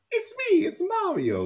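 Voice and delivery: very high, hammed-up Italian Mario voice; over-the-top Italian accent. Quoting the movie's commercial, over-the-top Italian accent. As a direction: ham up a cartoon Italian plumber. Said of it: "the over-the-top Italian accent".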